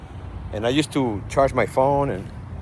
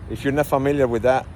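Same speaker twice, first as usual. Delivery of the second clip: usual speaking voice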